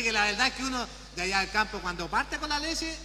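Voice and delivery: ordinary talk, nothing marked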